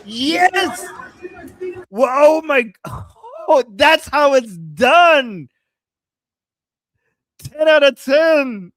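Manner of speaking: loud, high, drawn-out cheers; excited cheering. Real, not acted. Streamer cheering on a video clip, excited. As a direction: whoop it out slowly, high and loud.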